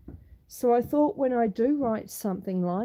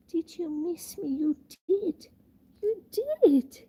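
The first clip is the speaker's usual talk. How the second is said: playful, raised-pitch pet voice; teasing affection for an animal, rising higher; pet talk; 6 semitones higher than usual. Real, not acted. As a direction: coo it high to a pet. Greeting a pet on arrival.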